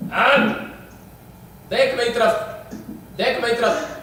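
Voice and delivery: exorcist challenge. Real, not acted. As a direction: confront the spirit, pausing after each demand. Challenges the spirit to look. (आत्मा को कड़ी चुनौती)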